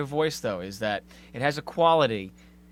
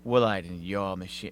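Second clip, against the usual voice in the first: lower, mock announcer read of the ad line; commercial voice; 7 semitones lower than usual. Guest quoting the host's TV ad voice back, lower mock announcer. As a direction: imitate her voice-over pitch. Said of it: "All I know is you said".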